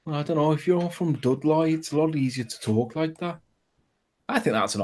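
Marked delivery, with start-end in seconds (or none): 0.1-3.4 put-on Birmingham accent, raised in pitch; Brummy accent. Shown as a demonstration. Showing the panel he can do it, Brummy accent. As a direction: show off your Brummie. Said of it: "I can do a Brummy accent".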